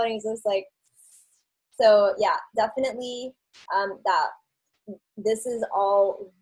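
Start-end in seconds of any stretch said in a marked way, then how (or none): none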